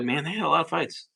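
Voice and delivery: ordinary delivery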